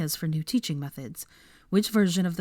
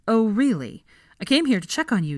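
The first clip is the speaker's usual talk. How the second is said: louder, higher exasperation; exasperated Harry; 5 semitones higher, 5 dB louder than usual. Acted. Harry scolding Hermione for overworking, sarcastic loud.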